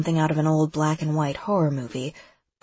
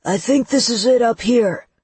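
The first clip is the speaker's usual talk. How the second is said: higher, louder call; yelling; 6 semitones higher, 5 dB louder than usual. A narrator's reading. Seth yells back to Lex, shouting. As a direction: shout it back over the engine. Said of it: "slows way down and yells".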